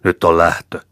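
loud, rough shout at the coffin; loud growling command; gruff bark. A narrator's reading. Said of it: "potkaisi vielä arkkua ja öyrähti".

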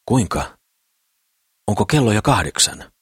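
a lightly voiced, startled question; mildly bewildered, just woken; a hint of dwarf amazement under his blanket; astonished. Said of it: "ällistelee kääpiö".

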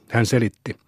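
usual speaking voice

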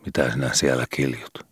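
mildly groggy, muttered question; sleepy growl. A narrator's reading. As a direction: grumble softly as if half asleep. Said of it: "isänsä unisena mörisevän".

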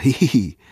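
high "hihihi" titter; a quick mocking titter; giggle. Muttinen giggles at Bungman, high tittering laugh. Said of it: "nauroi Muttinen".

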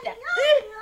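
loud ow ow from a kick; pained cry. Acted. Kicked neighbour cries out in pain. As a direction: yelp from a kick.

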